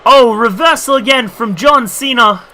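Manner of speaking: ordinary talk, nothing marked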